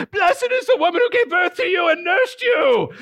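high, hammed-up shouted praise; over-the-top cheer. Preacher daring listeners to praise someone, over-the-top cheer. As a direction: holler it like a rowdy compliment. Demonstrates a shouted compliment to try.

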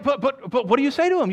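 usual speaking voice

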